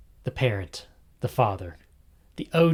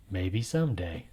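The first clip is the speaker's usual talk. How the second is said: ordinary delivery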